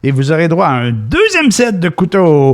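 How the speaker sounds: high, hyped TV pitchman; infomercial. Pretend. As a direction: push the deal like a TV ad. Parody TV shopping ad. (voix de télé-achat enthousiaste)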